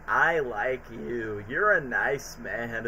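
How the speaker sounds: a hint of a slow, low, soft Percy voice; lightly voiced train, drawn out slowly and softly; Percy's line. Percy voiced sweetly in retelling.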